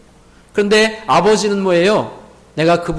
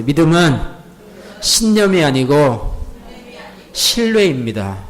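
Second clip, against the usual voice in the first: slow, measured call for repetition; slow repeat-after-me; 3 semitones lower than usual. Shown as a demonstration. 회중에게 따라하라고 천천히 또박또박. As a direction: spell out each phrase slowly for the crowd. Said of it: "또 하나가 있어요".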